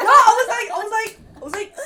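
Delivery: high, loud laugh breaking in. Real, not acted. Telling friends her period story, laughing. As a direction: laugh it out before going on.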